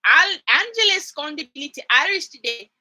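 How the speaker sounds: loud, hammed-up Irish accent; mock Irish accent. Imagining Josh hailing Angelus on set, loud mock Irish accent. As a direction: boom out a badly faked Irish accent. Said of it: "and said".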